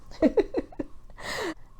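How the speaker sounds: light breathy chuckle; amused little laugh to herself; soft giggle after a wry admission. Real, not acted. Laughs after husband doesn't understand.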